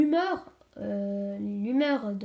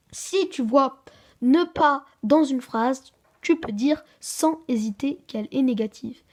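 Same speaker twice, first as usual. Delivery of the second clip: slow and much louder, spelling out the rule; emphatic rule; 2 semitones higher, 7 dB louder, slower than usual. Shown as a demonstration. Child teacher lays down the grammar rule, slow and loud. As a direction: drive the rule home to the class.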